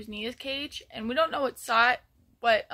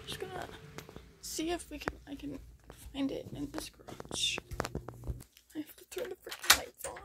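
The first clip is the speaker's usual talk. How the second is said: hushed whisper in the garage; 4 semitones higher, 8 dB quieter than usual. Real, not acted. Searching the dark garage, whispered.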